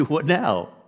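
loud and high WHAT now; lottery winner overjoyed; thrilled. Retold or imitated. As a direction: burst with joy on What now. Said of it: "They say".

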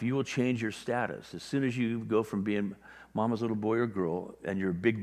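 normal talking voice

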